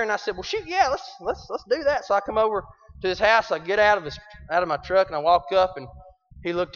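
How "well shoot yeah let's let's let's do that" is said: high, quick, eager agreement; eager yes. Retold or imitated. To the banjo teacher on the phone, eager and high. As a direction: jump at the invitation.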